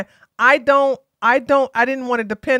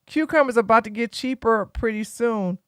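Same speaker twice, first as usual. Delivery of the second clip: ordinary talk, nothing marked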